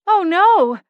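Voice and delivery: high, loud cry of fake alarm; mock alarm over the fallen elf; feigned alarmed cry. A narrator's reading. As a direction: cry out in put-on panic. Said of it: "I heard her call out".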